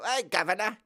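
a Brit faking a Cockney greeting; fake Cockney. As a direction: hail him in a hammed-up Cockney. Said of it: "if they were faking".

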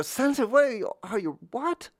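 high squeaking disbelief; incredulous. Retold or imitated. Child answering the teasers, high incredulous squeak. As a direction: shoot it up high in outraged surprise. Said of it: "I go".

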